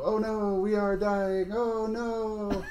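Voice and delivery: high, hammed-up wailing of tiny skulls; chorus of dying skulls. GM voicing the dying little skulls for the players, high hammed-up wailing. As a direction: wail it high like little skulls crying out in mock agony. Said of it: "a pitch off from each other, go".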